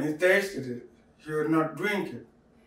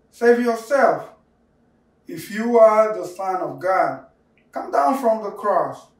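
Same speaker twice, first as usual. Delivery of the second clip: loud, raised-pitch jeer; derisive taunt; 5 semitones higher, 5 dB louder than usual. A narrator's reading. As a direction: taunt him with emphatic commands. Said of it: "derided him".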